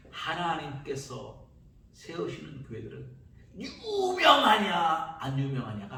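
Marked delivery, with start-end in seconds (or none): 3.6-5.2 much higher, very slow drawn-out 유명하냐; mocking. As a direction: stretch 유명하냐 in mock disdain. Echo of mocked phrase. (유명하냐를 길게 비꼬듯)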